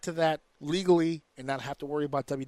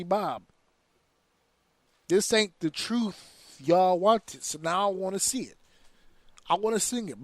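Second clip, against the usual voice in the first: ordinary delivery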